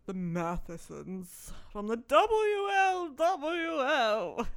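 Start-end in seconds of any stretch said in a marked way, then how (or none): 1.9-4.5 drawn-out, higher-pitched mock announcement; mock grandeur. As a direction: draw out the initials with mock importance. Mock-grand naming of the group.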